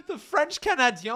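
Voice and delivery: high, loud, incredulous "Canadien" ending in "-dyo"; mocking own mispronunciation. Real, not acted. Streamer laughing at own slip, high mocking "Canadien" as "ka-na-dyo". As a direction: repeat "Canadien" as garbled "ka-na-dyo", aghast. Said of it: "that's not English or French".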